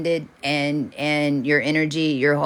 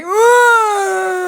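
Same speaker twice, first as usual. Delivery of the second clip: much higher, louder cry of wonder; amazed whoa; 8 dB louder than usual. Retold or imitated. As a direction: let out a stunned whoa. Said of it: "we're like".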